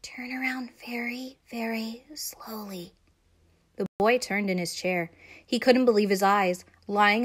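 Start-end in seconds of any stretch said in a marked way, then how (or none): 0.0-2.9 slow, hushed warning; barely audible, drawn-out caution; whispered. A narrator's reading. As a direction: whisper it so the danger won't hear. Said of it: "she whispered".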